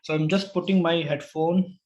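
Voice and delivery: ordinary delivery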